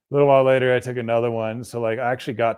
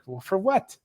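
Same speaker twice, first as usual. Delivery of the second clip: retold challenge; 9 semitones higher, 3 dB quieter than usual. Retold challenge to hospital staff.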